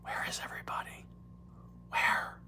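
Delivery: fast breathy whisper; Joe Biden whisper. Retold or imitated. Host whispers to chat as Biden. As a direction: hush it like Biden. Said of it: "I'm doing my Joe Biden again".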